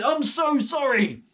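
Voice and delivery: high, loud and slow apology; Saul pleading. Acted. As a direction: stretch out the sorry loudly. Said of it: "And he said".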